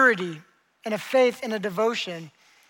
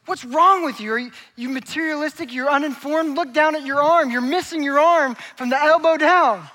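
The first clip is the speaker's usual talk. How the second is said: raised, emphatic incredulous scolding; exasperated police officer; 5 semitones higher, 5 dB louder than usual. Acted. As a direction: play an officer trying to shock sense into him. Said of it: "the police officer goes".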